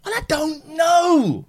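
high, slow, drawn-out feigned doubt; coy mock hesitation. Mum pretending to hesitate to her sons, high coy drawl. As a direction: play coy, stretching it out up high. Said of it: "I said".

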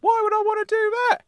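high-pitched indignant uncle character; squeaky-high, affronted comic voice; Uncle Claude. Acted. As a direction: play a worked-up uncle way up high.

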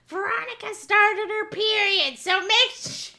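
high, loud grandmother yelling across the yard; yelling granny. Retold or imitated. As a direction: broadcast the news to the whole street. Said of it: "yells across the fucking front yard".

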